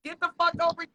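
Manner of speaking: normal talking voice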